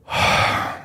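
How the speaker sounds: long, breathy sigh; acted heavy sigh. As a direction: let out a long, weighty exhale. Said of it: "long sigh, he goes".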